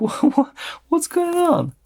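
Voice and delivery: high-pitched, stammering disbelief; baffled surprise. Real, not acted. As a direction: stumble upward into a baffled, half-laughing question. Baffled by crooked, broken chip.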